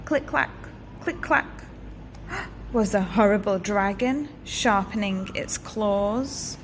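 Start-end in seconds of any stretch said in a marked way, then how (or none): 0.1-1.5 clip-clop hooves. A narrator's reading. Horse hooves approaching in story.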